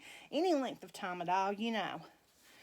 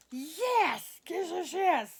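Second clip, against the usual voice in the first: high, louder, bouncing yeses; excited; 6 semitones higher, 5 dB louder, much slower than usual. Real, not acted. Celebrating with viewers, high excited yeses. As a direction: cheer it out. Celebrating the doll being rerouted.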